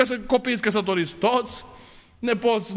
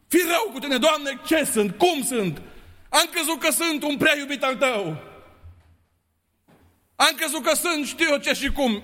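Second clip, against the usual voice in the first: fervent preaching; 4 semitones higher than usual. Real, not acted. Preaching self-examination.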